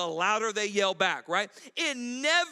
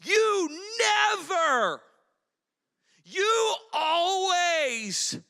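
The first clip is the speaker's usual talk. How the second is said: drawn-out accusing yell, higher and louder; mock accusing yell; 7 semitones higher, 7 dB louder, much slower than usual. Preacher acting a spouse fighting, slow accusing yell. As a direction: fling never and always at your spouse. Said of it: "our go-to words".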